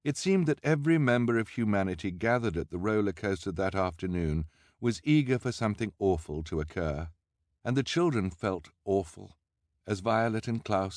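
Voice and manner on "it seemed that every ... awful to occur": usual speaking voice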